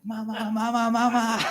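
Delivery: high, loud mock wail for mama; imitated child's scream. Disciplined son screams for his mom, imitated mock screaming. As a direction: imitate a kid yelling for his mother. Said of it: "he was screaming for mom".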